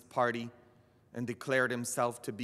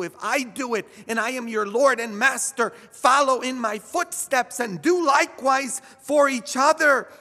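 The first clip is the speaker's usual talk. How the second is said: preaching at full force; 13 semitones higher, 12 dB louder than usual. Acted. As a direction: lift the congregation with each phrase.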